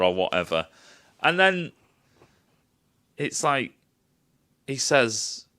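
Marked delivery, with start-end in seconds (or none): none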